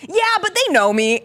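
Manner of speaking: high, insistent comeback; retold pleading protest. Acts out arguing with Chris.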